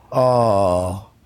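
slow, low Cuban-accented mimicry; Cuban lady impression. Lost Cuban Uber driver turning around, slow accented confusion. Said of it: "doing a dead impression of what she did".

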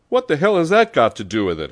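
fast, high, loud howl. A narrator's reading. Pepone to the calm little man, howling. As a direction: howl it in exasperation. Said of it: "howled Pepone".

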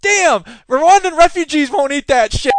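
Chris Rock bit. Retold or imitated. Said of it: "And he goes".

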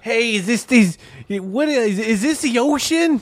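very high-pitched mock Jersey accent. Imitating a TV clip from Jersey, high Jersey accent. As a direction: squeal it in an exaggerated Jersey accent. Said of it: "you gotta do the accent".

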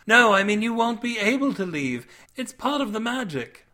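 high-pitched maiden voice; Cindy. A narrator's reading. Maiden explains why he can't leave, high voice. Character dialogue in narrated story.

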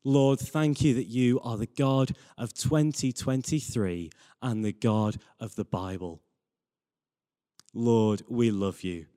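normal talking voice

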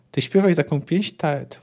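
ordinary talk

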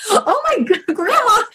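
high-pitched cry of disbelief; shocked outcry. Retold or imitated. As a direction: squeal it out, stunned at grandma. Grandma springs surprise funeral stop.

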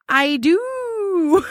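high, drawn-out "I dooo"; mock-romantic reply. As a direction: stretch it out like a swooning texter. Acting his drawn-out romantic reply.

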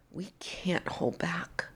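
barely holding it together, whispered; emotional whisper. Real, not acted. Speaking to listeners, moved, in an emotional whisper. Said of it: "I'm getting very emotional right now".

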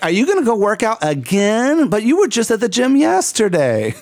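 high-pitched, exaggerated disbelief; mock disapproving relative. Relative objecting to yet another gym trip, mock dismay.

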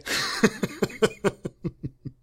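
quiet, breathy chuckle; laughing at his own nonsense; soft laugh. Real, not acted. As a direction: let out a voiceless little laugh. Laughs after absurd riddles.

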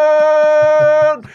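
huge high yelled call, stretched long; jungle roar. Retold or imitated. Leader signals the kids with a jungle roar. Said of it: "djungelvrål som en signal".

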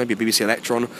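normal talking voice

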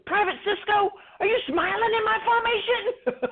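high mock drill sergeant bark; imitating a drill sergeant, laughing. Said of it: "he goes".

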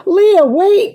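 high, loud call after someone; urgent cry. A narrator's reading. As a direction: cry out to stop her. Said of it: "cried Virginia".

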